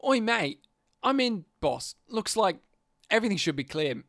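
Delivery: bogan-leaning Australian line reading; bogan accent. Shown as a demonstration. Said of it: "I did the fucking Bogan accent".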